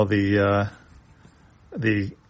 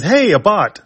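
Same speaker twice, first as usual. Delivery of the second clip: excited; 9 semitones higher, 6 dB louder than usual. Acted. Ruddock greets robot eagerly.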